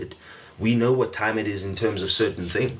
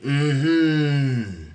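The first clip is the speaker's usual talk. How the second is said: long, rising-then-falling higher "mm-hmm"; spirit's assent; 4 semitones higher than usual. Retold or imitated. Host voices your spirit agreeing to be here, drawn-out "mm-hmm". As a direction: hum a drawn-out knowing yes. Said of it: "Your spirit said".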